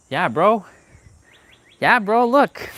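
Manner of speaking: loud, high hype shout; hyped. Real, not acted. Hyping the walker to viewers, loud excited shout. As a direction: pump it up like a gym bro.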